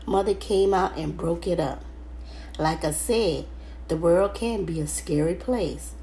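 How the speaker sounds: usual speaking voice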